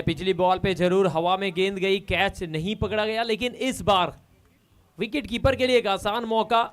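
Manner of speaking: normal talking voice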